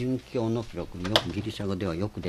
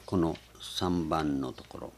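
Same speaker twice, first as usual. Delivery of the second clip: normal talking voice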